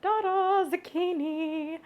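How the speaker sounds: ta-da reveal. Acted. Unveiling the vegetable.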